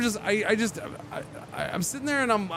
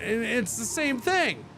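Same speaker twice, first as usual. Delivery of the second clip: ordinary delivery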